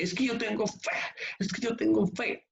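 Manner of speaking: mock whiny believer voice, high-pitched. Preacher mocks a believer who only claims faith, high whiny voice. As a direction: mimic the doubter's lame excuse.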